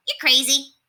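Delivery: high, bright rat voice; lightly voiced rat, exclaiming; high-pitched rat scoffing at a friend; excited rat. A narrator's reading. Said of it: "declared Septimus".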